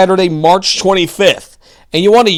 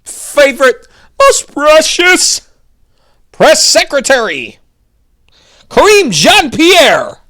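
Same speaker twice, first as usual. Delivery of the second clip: higher, slow mock-adoring sing-song; sarcastic praise; 8 semitones higher, slower than usual. Host mocking the press secretary with sarcastic sweetness. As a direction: drip with fake affection over the name.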